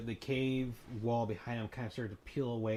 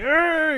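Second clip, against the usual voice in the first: loud high frustrated yell; angry growl; 15 dB louder than usual. Acted. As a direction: grunt in outrage. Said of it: "he's like".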